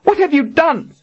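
high, furious accusation; wild fury. Acted. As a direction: hurl the question at her in fury. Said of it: "With wild fury".

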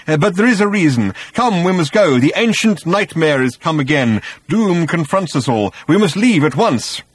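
urgent, high, loud alarm; panicked old man urging haste; breathless and agitated. A narrator's reading. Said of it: "breathless and agitated".

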